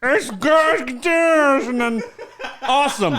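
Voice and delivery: high, hammed-up imitation of deaf speech; mock deaf speaker. Joking Helen Keller judges a painting, mock deaf voice. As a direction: push out distorted words as a mock deaf speaker. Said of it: "Helen Keller".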